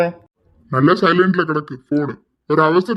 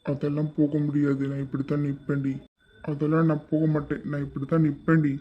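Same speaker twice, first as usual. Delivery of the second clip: much softer and lower, stubborn murmur; hushed defiance; 4 semitones lower, 8 dB quieter than usual. Acted. Husband refusing to move away from his wife, hushed and stubborn. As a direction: dig in quietly, teasing.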